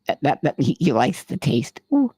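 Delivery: usual speaking voice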